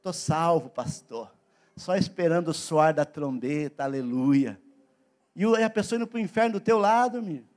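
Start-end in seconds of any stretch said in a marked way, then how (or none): 0.0-4.6 low, soft, mock-contented voice; complacent believer. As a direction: sink into idle self-satisfaction, imitating. Imita crente acomodado falando ao pastor. (crente acomodado, voz baixa e mole)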